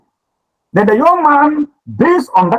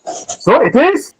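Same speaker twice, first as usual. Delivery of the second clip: So it is said high and slow; stress and high rise; 9 semitones higher, much slower than usual. Shown as a demonstration. Teacher modelling So it is for the students. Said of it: "that's the word".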